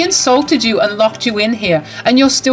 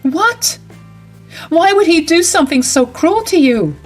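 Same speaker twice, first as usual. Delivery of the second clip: higher, a disbelieving cry; shocked outrage; 8 semitones higher than usual. Acted. Bride learning the groom locked her in, high and shocked. Bride hears Ned did it.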